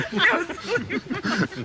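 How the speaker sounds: high burst of laughter. Real, not acted. Laughing after the lobbyist calls her a silly boy.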